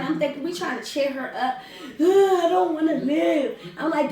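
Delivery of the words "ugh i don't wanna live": higher, louder hammed-up wail; mock crying. Crying cousin while they cheer her up, mock wailing. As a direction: groan into a melodramatic sob. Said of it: "she's still crying".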